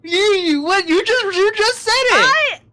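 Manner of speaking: very high, very loud squeal of shock; shrieking disbelief. Real, not acted. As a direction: scream in gleeful disbelief at the slip. Friend reacts to accidental rude word.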